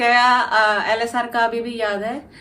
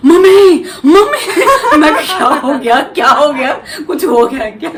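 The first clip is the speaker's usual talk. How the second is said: much louder and higher, laughing as you call mummy; excited shouting; 4 semitones higher, 12 dB louder than usual. Retold or imitated. Mother retelling daughter shouting at night, loud and laughing. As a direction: burst in shouting for mom, then her laughing worried reply.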